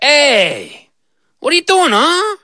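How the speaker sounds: high cheeky goat voice; brash goat challenging the girl. Acted. Goat confronting the little girl, cheeky high voice.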